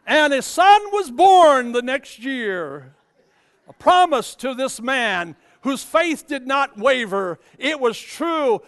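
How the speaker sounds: slow, loud proclamation; triumphant preaching. Acted. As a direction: land the climax slowly and loud. Triumphant climax of sermon.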